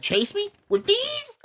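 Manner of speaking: imitated cartoon wolf, pitched high; incredulous comeback leaping into falsetto; cartoon wolf retort. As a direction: mimic an indignant cartoon wolf, voice shooting up. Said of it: "He's like".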